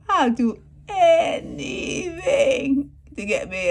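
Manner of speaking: high, loud, slow whiny plea; mock pleading. Vlogger mimicking the boy's plea, high, loud and slow. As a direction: wail it like a begging teen. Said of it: "come over here so you can see".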